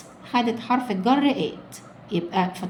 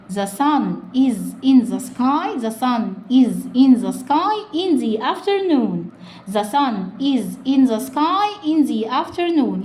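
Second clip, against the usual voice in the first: slow reading; slower than usual. Shown as a demonstration. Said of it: "slow model reading".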